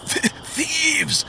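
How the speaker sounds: a hurt man forcing the word out; groaned. Acted. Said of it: "the pilgrim groaned".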